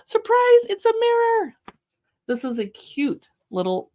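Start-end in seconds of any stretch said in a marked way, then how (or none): 0.1-1.5 much higher, louder joyful reveal; spring the surprise on the baby; excited surprise. Shown as a demonstration. Revealing the mirror page to a baby, loud joyful surprise. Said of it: "read it with an enthusiastic tone of voice".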